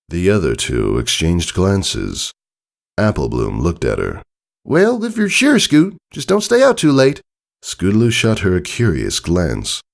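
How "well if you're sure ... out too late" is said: high, louder country filly; Applebloom's voice. Acted. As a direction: speak up high like a caring young pony.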